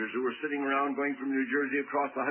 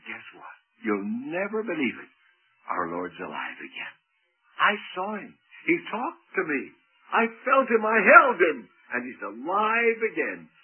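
breathless excitement; 3 semitones higher, 5 dB louder than usual. Acted. Mary tells disciples excitedly.

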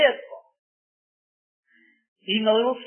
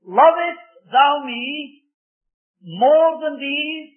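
"Lovest thou me more than these?" drawn out very slowly, louder and higher; slow searching question; 6 semitones higher, 5 dB louder, much slower than usual. Shown as a demonstration. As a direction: stretch each word of the question. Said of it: "Now watch the test again".

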